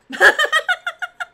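loud high-pitched laugh. Real, not acted. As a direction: burst out laughing. Laughing at own remark.

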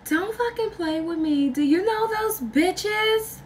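put-on high-pitched, drawn-out girlfriend demanding an answer; mock jealous girlfriend. Jealous girlfriend confronting her man, retold.

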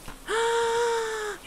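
sharp high gasp of fright; startled gasp. Real, not acted. Player spooked inside the dark haunted house, startled high gasp. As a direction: gasp hard as something jumps out. Sudden scare in ghost game.

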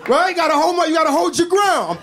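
high, loud insistence; emphatic. Real, not acted. Advising viewers to stand firm, loud. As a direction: hammer the point home loudly.

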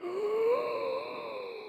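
sharp high gasp of mock horror; shocked gasp. Comic gasping at the sassy child's reply. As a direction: gasp as if scandalized.

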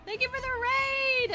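high bright shout of thanks; excited thanks. Real, not acted. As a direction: cheer your thanks to the raiders. Thanking raiders again.

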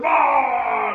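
loud shouted mock bear roar; hammed-up beastly bellow. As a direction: roar while hurling something heavy. Bear lifting the piano.

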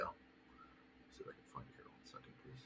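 whispered aside to himself. Real, not acted. Coach hunting for an email, whispering to himself. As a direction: murmur it under your breath while searching. Searching files, muttering aside.

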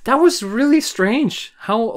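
high, slow, baffled voice. Real, not acted. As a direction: drag it out in disbelief. Reacting to odd scene.

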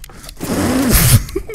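high-pitched burst of laughter. Real, not acted. Lost the round.

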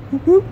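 quick high-pitched double whoop. Real, not acted. Showing off cars at the show, high loud whooping.

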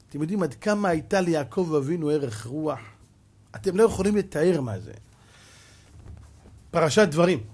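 ordinary talk

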